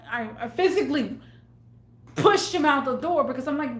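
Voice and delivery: normal talking voice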